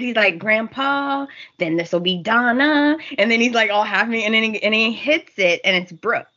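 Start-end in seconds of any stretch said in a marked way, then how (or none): none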